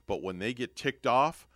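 ordinary talk

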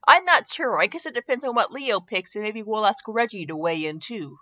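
ordinary delivery, nothing marked

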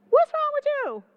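very high, loud-edged squeal of a question; acted high-pitched wife; exasperated wife. Jezebel comes in on her sulking husband, high exasperated voice.

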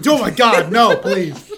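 laughing plea. Real, not acted. Begging not to dissect art.

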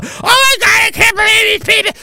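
mock a spoiled Canadian, whining high; mocking entitled Canadian. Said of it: "The fucking arrogance of, you know, fat, entitled, you know, Canadian people".

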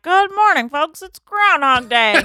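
high, loud, cheesy TV host voice; mock weatherman greeting. Impersonating Phil greeting the town on TV, high and loud. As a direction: beam it out like a chirpy weatherman.

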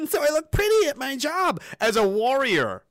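mock soldier voice. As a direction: lampoon the soldier who wants to look pretty.

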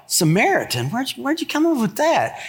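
incredulous, rising to high on the question; baffled, where did that come from; puzzled. Retold or imitated. Bystanders baffled by the accusation, high incredulous question.

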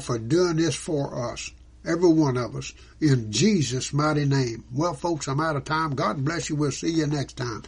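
ordinary talk, nothing marked

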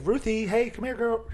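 high sing-song call to a dog. Retold or imitated. Calling Ruthie the dog back in, high coaxing. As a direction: coax the dog in a high sweet voice. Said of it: "I was like".

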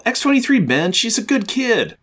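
high, lightly voiced young hero; Spidey. Hero telling friend she's a good kid, high comic-book voice. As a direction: pitch it up to vouch for someone. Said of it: "and Spidey's all".

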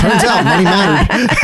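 laughing through the words; chuckling as she says it. Real, not acted. Joking about next year's stats.

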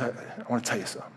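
soft, fast, near-whispered confidence; retold whisper. Father-in-law confiding at the sink, quick and hushed. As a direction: lean in and tell a secret. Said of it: "he whispers in my ear".

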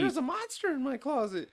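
very high-pitched mock child voice; cheesy little girl. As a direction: imitate a little girl in squeaky cheesy acting. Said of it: "That little girl was too cheesy for me".

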